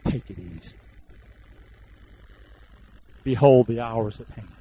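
very low voice with long pauses; low, resigned quote. Acted. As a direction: drop deep and let each phrase hang. Acts Jesus' resigned words to disciples.

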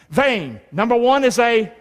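ordinary talk, nothing marked

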